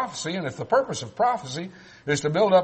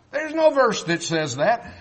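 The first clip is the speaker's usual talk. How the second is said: ordinary talk, nothing marked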